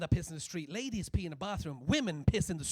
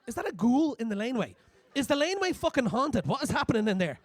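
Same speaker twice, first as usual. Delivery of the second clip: loud, high, spooked calls into the dark; spooked loud calling; 5 semitones higher, 8 dB louder than usual. Retold or imitated. Friend calling into the haunted-sounding laneway, spooked and loud.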